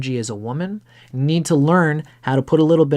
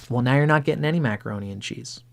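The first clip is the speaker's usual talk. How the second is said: ordinary delivery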